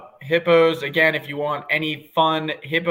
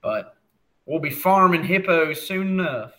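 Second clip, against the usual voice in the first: lightly voiced cowboy rancher; 3 semitones higher, 3 dB louder than usual. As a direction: say it like a ranch hand, a touch higher. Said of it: "That was my rancher voice".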